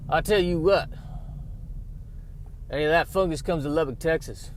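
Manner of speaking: Texan tough talk, a bit hammed-up; put-on Southern Texas accent. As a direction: threaten it in a folksy Texas accent. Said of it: "doing a, a Texas, a Southern Texas accent".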